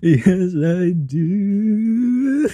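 high wailing sing-song mockery; mock crying singsong. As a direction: sing it high like a whiny cry. Mimics the streamer's crying song.

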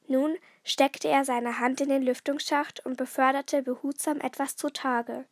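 plain reading voice

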